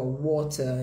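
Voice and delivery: British "water", crisp T, ending in "ah"; sotaque britânico. Shown as a demonstration. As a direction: say "water" as "waw-tah", British. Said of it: "em inglês americano e britânico". (sotaque britânico, T claro, sem R)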